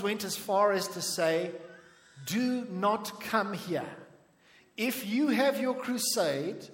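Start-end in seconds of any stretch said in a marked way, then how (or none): 2.3-6.7 slow, deliberate retold warning; slow threat. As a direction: spell out the threat slowly, word by word. Acting out hostile locals' threat.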